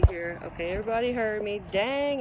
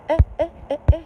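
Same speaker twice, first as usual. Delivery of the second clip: playful eh; 7 semitones higher, 11 dB louder, much faster than usual. Real, not acted. Higher, louder playful hum.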